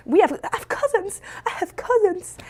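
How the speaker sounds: high, laughing announcement; retold giddy excitement. Child telling mom about new cousins, high giddy excitement. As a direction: gush it up high through a laugh.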